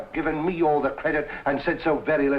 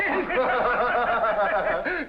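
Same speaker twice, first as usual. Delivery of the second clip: hearty laugh; 8 semitones higher than usual. Acted. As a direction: laugh heartily at good old Holmes. Inspector laughs at Holmes' experiments.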